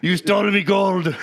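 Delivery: high, loud Irish leprechaun growl; mock the movie leprechaun's Irish accent. Friend imitates the leprechaun movie villain, mock Irish accent. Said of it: "the leprechaun movies".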